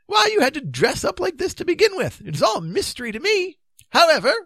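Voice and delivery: high, sorry bewilderment; plaintive. A narrator's reading. King bewildered by the disguise, plaintive. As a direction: complain sadly in a high king's voice. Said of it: "said the king sadly".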